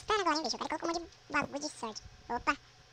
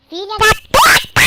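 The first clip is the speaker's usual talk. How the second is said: slow, very loud, high curse; furious shout; 8 semitones higher, 25 dB louder than usual. Real, not acted. Player falls again, screams a curse. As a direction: bellow the swear out.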